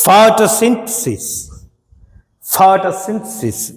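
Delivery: Photosynthesis slow, weight on ఫో; stress on first syllable. Shown as a demonstration. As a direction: drill photosynthesis hitting ఫో. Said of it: "ఫస్ట్లోనే ఉంది". (ఫో మీద ఒత్తిడితో నెమ్మదిగా ఫోటోసింథసిస్)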